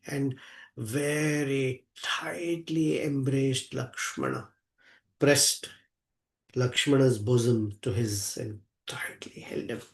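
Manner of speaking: hushed narration. A narrator's reading. Narrating Rama hugging Lakshmana, in a tender whisper.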